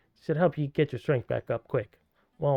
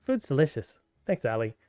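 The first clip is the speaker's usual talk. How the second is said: ordinary talk, nothing marked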